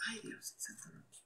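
hushed whisper. Real, not acted. Whispering agreement to a viewer's comment. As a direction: breathe it out barely voiced.

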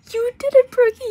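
shrieking with delight over the win; ecstatic shout. Real, not acted. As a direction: scream it out in triumph. Friend cheers about beating rivals.

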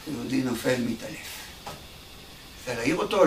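normal talking voice

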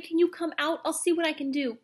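high, urgent offer of help; distressed cry. A narrator's reading. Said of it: "cried Omri".

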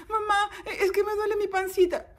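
put-on high child voice, complaining; child in pain. Child with real tummy ache telling mom, high plea. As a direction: plead to mom about a sore tummy. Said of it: "le dices".

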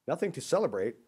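can't believe her ears; surprised. A narrator's reading.

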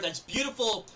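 ordinary talk

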